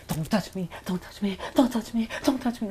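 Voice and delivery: frightened plea. Retold or imitated. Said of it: "She's like".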